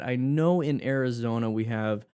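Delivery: ordinary delivery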